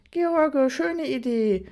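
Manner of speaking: high, loud cheer of surprise; delighted exclamation. Acted. Guest greets the Santa idea with gleeful delight. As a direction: praise George's surprise with glee. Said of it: "überraschten Ausrufe".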